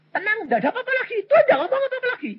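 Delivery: high, fast laughing rush of relief. Real, not acted. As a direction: laugh it out, suddenly light. Relief after God's rebuke. (tertawa lega, tinggi dan cepat)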